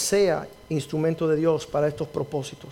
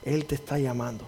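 reassuring; 7 dB quieter than usual. Retold or imitated. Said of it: "al ciego diciendo".

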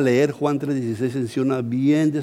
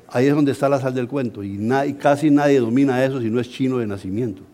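usual speaking voice

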